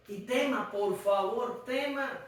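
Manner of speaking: soft pleading. Real, not acted. Pleading to fear God.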